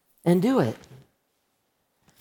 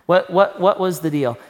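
usual speaking voice

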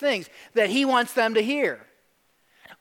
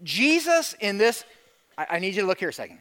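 ordinary delivery

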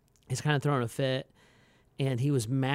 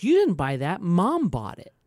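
lightly voiced kid; 4 semitones higher, 5 dB louder than usual. Kid correcting dad.

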